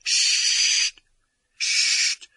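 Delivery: loud hissing whisper; hissed shush. A narrator's reading. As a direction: hiss it sharply. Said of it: "siffla le professeur McGonagall".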